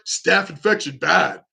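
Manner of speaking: lightly voiced monster talk in blunt chunks; a hint of caveman monster; Hulk or Frankenstein. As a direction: grunt it like a simple brute, half-hearted. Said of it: "it's like the Hulk or Frankenstein".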